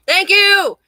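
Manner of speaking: loud, high, triumphant thanks; emphatic thank you. Real, not acted. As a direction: cheer your own point. Repeated cheer, even higher.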